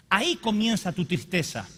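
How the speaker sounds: ordinary delivery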